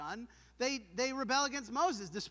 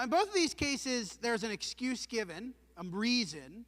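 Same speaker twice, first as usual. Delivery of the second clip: usual speaking voice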